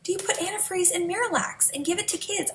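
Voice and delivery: high, disbelieving inner question; 1 incredulous. Retold or imitated. Mother reading the ingredients, disbelieving and high. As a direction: sound baffled and outraged at what you found. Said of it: "And I thought".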